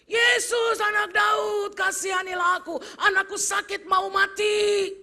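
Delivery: high, loud pleading cry; desperate shout. Acted. Mother shouting to Jesus through the crowd. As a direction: plead at the top of your voice.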